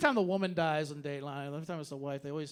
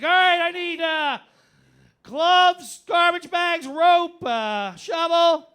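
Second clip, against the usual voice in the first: high, loud, frantic list-reading; hammed-up flustered killer at the store; panicked murderer shopping; 11 semitones higher, 10 dB louder, slower than usual. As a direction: blurt each item like a husband in a panic. Acts frantic husband buying murder kit.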